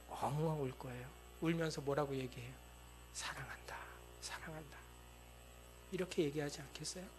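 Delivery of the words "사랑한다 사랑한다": whispered, breaking with tears; a parent's choked whisper of love; tearful whisper. Acted. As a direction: hold the child and whisper it through tears. Parent weeping over hurt child.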